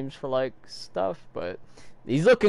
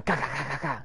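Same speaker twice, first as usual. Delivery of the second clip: rapid-fire gun noise with the mouth; mimicked machine-gun rattle; stuttering shots as a sound effect; imitated gunfire; 2 semitones higher, 4 dB quieter than usual. Everybody's shooting at him.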